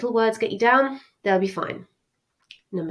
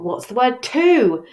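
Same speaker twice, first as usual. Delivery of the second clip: higher, louder, drawn-out question; puzzled, slowly stretched question; puzzled student's question; 5 semitones higher, 6 dB louder, slower than usual. Acted. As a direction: voice a student's slow, emphatic query. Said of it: "you might be thinking".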